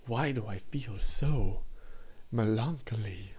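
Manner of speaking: a touch of slow mopey quoting; movie quote. Retold or imitated. Streamer quoting Megamind, slow and mildly mopey. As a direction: sigh it out like a sulky character. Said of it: "every single line is so quotable".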